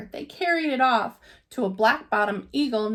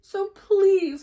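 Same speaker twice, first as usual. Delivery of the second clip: pleading please; 8 semitones higher, 5 dB louder than usual. A narrator's reading. Horton begs the kangaroo.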